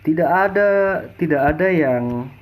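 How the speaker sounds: usual speaking voice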